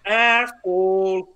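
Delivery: long, drawn-out mock Canadian accent. Podcaster mimics how wrestlers said it, exaggerated Canadian accent. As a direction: stretch it slowly in an imitated Canadian accent. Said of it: "the so Canadian way they said it".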